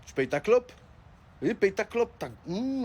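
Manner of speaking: normal talking voice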